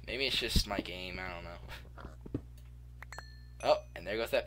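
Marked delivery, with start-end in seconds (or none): 1.2-2.4 whispering. Real, not acted. Muttering to himself while mining.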